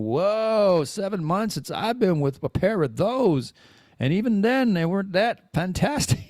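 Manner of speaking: half-joking gleeful aside; mock excited. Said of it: "And I'm like".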